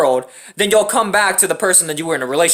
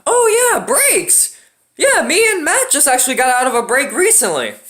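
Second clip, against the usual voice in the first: higher, breezy mock voice of the ex; mocking ex girlfriend; 5 semitones higher than usual. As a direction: lightly mimic her careless chirp. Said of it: "decided to say this to my dad".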